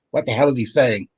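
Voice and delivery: ordinary talk, nothing marked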